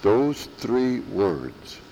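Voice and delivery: very slow, each word weighed; slow emphasis. Shown as a demonstration. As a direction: lean on those three words. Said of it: "I repeat with emphasis".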